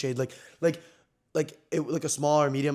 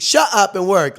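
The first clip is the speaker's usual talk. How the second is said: loud, high imitated order; bossy mock shout; 6 semitones higher, 12 dB louder than usual. Sty jokingly ordering his hired helper, bossy shout. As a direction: act out a bossy telling-off. Friend jokingly bosses him on live.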